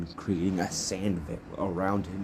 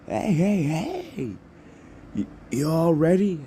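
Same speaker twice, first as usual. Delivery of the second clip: high, loud hype-man call; excited announcer shouting for readiness; President Mike; 10 semitones higher, 4 dB louder, slower than usual. Acted. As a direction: rile up a crowd in a high voice. President Mike hypes up examinees.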